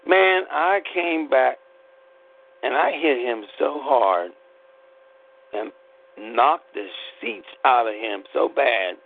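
ordinary delivery

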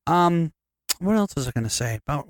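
ordinary delivery

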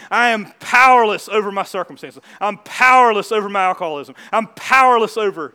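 loud pleading confession; powerless, pleading at full volume; earnest prayer. Pretend. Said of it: "you put it in the blank".

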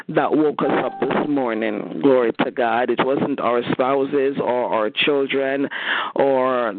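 rising, impassioned prayer voice building toward a climax; fervent prayer. Real, not acted. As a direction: church prayer leader pouring out thanks.

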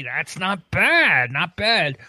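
ordinary talk, nothing marked

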